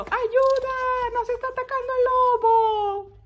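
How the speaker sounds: very high, loud alarm cry; shepherd screaming. Acted. Shepherd under wolf attack calling the village, screaming. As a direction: scream for help as the boy. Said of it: "el pastorcito aquí gritando".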